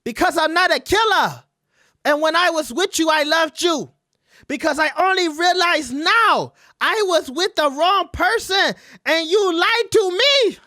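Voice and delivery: high, loud falsetto girlfriend impression; high mock voice of the pregnant girl; mocking woman voice. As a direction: ham up the upset woman. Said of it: "She said".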